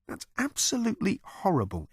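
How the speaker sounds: mild appalled exclaim; lightly voiced Arthur exclaiming. A narrator's reading. Arthur appalled at the cow, in a mildly voiced exclaim. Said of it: "exclaimed Arthur".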